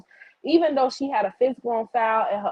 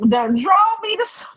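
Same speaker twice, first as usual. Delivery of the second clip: laughs mid-line, higher and louder; laughing; 12 semitones higher, 5 dB louder than usual. Real, not acted. To her chat, laughing that they drove her to smoke.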